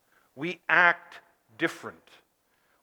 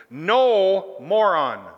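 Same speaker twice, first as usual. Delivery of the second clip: loud curt "No, moron"; blunt put-down; 4 dB louder, much slower than usual. Retold or imitated. Paul rebuking the questioners, loud curt dismissal. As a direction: toss it off as a louder blunt retort. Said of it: "let me try it like this".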